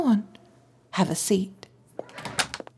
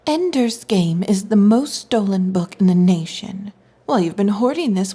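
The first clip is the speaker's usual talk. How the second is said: ordinary talk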